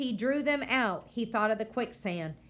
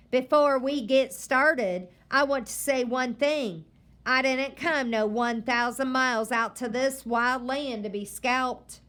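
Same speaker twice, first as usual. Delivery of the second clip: stern grandma; 4 semitones higher, 6 dB louder than usual. Acted. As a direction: warn the boy firmly. Grandma lecturing the boy.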